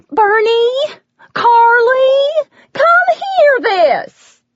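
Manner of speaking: very high, loud call toward the kitchen; waitress shouting to the cook and coworker. Acted. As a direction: holler for the others to come quick. Waitress calling kitchen staff over.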